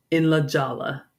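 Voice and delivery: ordinary talk, nothing marked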